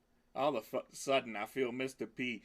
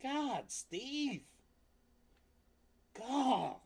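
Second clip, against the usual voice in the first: exasperated groan; 10 semitones higher, much slower than usual. Real, not acted. Exasperated at the device.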